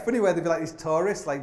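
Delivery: ordinary delivery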